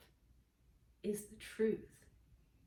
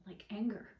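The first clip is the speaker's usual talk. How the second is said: whispered aside. Real, not acted. Whispering the word anger to the audience. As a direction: drop to a breathy whisper on anger.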